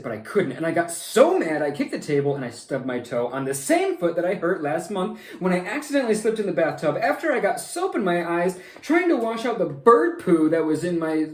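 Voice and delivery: put-on high, loud, fast wailing kid; upset little boy. Sam wailing to the monster about his awful day, high and loud. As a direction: pour out every grievance in one breath.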